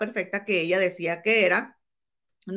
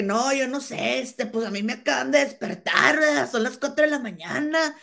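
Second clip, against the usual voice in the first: higher, whining imitation of an excuse; imitated protest; 5 semitones higher than usual. Retelling Lupillo's just-woken excuse, mock protest. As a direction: plead ignorance in a raised, put-on voice. Voices Lupillo claiming he knows nothing.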